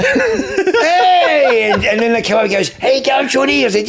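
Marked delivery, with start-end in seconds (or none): 0.1-1.7 very high, loud comic greeting; retold hearty greeting. Acts out greeting visitors. 2.8-3.7 high-pitched, animated hello; imitating hearty greeting. Acts out visitors' greeting.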